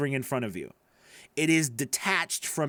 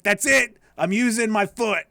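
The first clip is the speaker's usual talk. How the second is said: louder, higher, fired-up declaration; mock determination; 5 semitones higher, 5 dB louder than usual. Imagined player deciding to use the foot, loud and fired up. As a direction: announce it like a last resort, hammed up. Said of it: "now I'm like".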